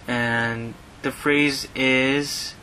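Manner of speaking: slow and drawn out; slow teaching pace. Shown as a demonstration. Teacher slowing down before a new phrase. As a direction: announce the phrase slowly. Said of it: "teacher slowing to introduce phrase".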